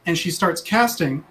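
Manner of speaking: ordinary delivery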